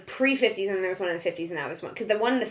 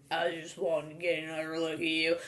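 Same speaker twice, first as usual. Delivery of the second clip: Southern accent, much lower and softer, mocking; mock low Southern drawl; 7 semitones lower, 12 dB quieter than usual. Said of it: "lowering your voice and putting on a fake Southern accent".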